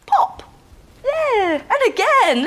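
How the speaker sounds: high squeaky pop of a bubble; playful pop. Pretend. Teacher pops Wendy's bubble for the children, squeaky and excited. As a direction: burst it out high and bright.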